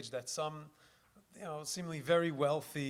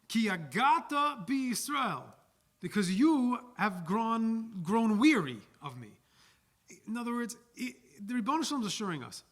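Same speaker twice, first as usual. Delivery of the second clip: loud, higher preaching voice pressing the point; fervent preaching; 5 semitones higher, 7 dB louder than usual. Acted. Rabbi driving home the sermon's message, loud and fervent. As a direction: preach it hard to the congregation.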